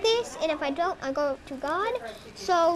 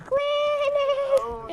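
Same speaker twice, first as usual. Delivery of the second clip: calling out; 10 semitones higher than usual. Real, not acted. Child calls out to nurse.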